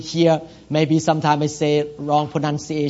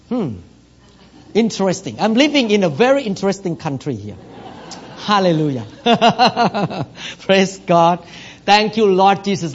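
usual speaking voice

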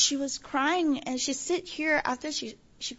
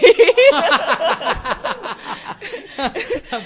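long, loud, high laughter; big laugh; 5 semitones higher, 13 dB louder than usual. Real, not acted. Church member laughs about visitors coming every day, big laugh. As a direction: burst out in a long peal of laughing.

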